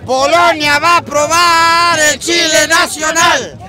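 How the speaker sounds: taunting. Real, not acted. Taunting announcement.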